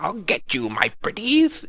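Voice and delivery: hunched over, crooning like a witch; wicked witch voice. Shown as a demonstration. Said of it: "that wicked witch voice".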